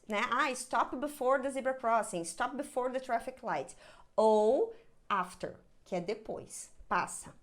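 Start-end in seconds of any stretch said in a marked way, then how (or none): none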